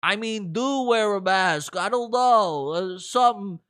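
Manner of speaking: hint of a fussy official; mild imitation of the doctor; mock Fauci. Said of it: "he goes".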